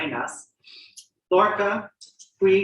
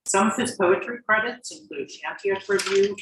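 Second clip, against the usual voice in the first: ordinary talk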